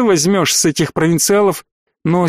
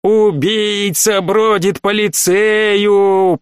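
very slow, drawn-out ghostly howl; spooky howl; 5 semitones higher, much slower than usual. Acted. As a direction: howl it to scare her. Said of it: "провыл Ройс".

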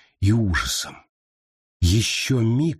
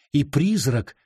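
ordinary delivery, nothing marked

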